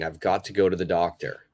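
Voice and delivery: "I've got to go to the doctor" run together fast; quick natural delivery. Shown as a demonstration. Teacher shows the natural speed, quick. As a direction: rattle it off like everyday speech. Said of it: "Same meaning".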